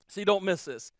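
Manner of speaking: normal talking voice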